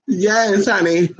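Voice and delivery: usual speaking voice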